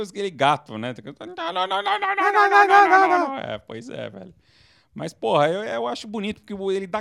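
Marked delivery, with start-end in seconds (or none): 1.4-3.4 very high repeated mock scream, swelling louder; hammed-up frantic string of cries; Shia. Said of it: "O Shia é aquele". (imitação de grito agudo e desesperado)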